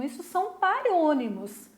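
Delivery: ordinary delivery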